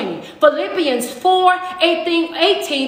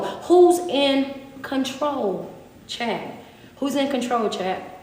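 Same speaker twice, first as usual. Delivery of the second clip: soft call and response; 3 semitones lower, 6 dB quieter, slower than usual. Acted. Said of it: "Some of y'all need to be screaming this".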